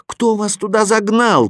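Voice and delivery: calling up. A narrator's reading. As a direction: call the question up a mountain. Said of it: "крикнул я им снизу".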